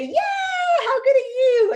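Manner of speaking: very high, loud gushing to a dog; over-the-top loud, high cheer; excited praise. Acted. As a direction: squeal delighted praise at a puppy. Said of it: "we're gonna say".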